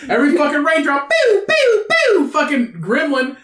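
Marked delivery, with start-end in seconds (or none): 1.1-2.3 high-pitched imitated zaps; high pew noises. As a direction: fire off high vocal pews. Imitating raindrops hitting gremlins.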